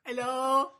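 very high, loud Australian hello; mock Australian accent. Comic repeating the hammed-up Australian hello. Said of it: "That's Australia, isn't it".

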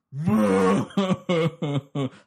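big loud bawling sob; loud wailing. Shown as a demonstration. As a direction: let it all out in a howl. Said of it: "cried like this".

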